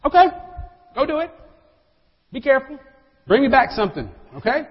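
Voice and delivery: casual neighbor's reply. Retold or imitated. Neighbor casually answering a friend's plans, light and high. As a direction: toss it off like an easygoing friend. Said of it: "what is that person gonna tell you in response".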